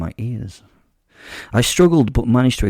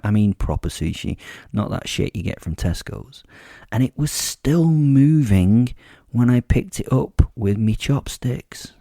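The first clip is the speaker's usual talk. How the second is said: plain reading voice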